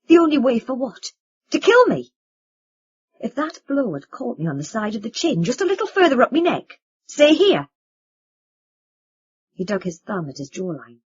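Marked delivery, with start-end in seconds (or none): 0.1-2.1 high, loud bitter echo; angry retort. Acted. Vin throws words back. 3.2-7.7 much higher, louder heated voice; rising heat, nearly shouting; angry. Acted. Vin angry.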